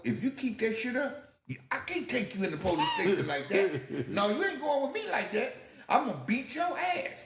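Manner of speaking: high-pitched mock threat; exasperated cop. Policeman stopping the car to threaten teen, exasperated. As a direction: scold in rising exasperation.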